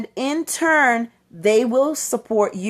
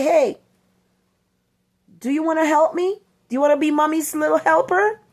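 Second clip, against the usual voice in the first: higher, bright mommy's-helper pitch; sing-song invite, pitched up, quick on the last line; cheery invite; 6 semitones higher than usual. Retold or imitated. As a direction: coax the child to join in.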